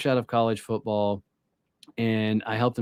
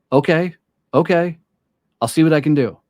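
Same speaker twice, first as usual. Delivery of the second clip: higher and louder, keen to close; eager phone voice; 5 semitones higher, 6 dB louder than usual. Retold or imitated. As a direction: sound like you're hustling a client on the line. Young salesman on phone call.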